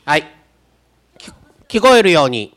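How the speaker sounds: loudly urging someone to speak up; loud call to audience. Real, not acted. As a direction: call out over the hall. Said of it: "大きな声で言ってください". (会場に向かって大きな声で呼びかける)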